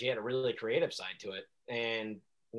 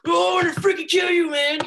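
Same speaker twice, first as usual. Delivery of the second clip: high, loud play-fight shout; mock action-figure yell; 12 dB louder than usual. A kid playing action figures, shouted high in character. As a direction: yell it like a toy hero threatening his enemy. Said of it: "literally mimic their voices".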